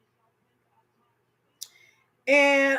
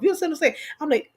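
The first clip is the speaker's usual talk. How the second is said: usual speaking voice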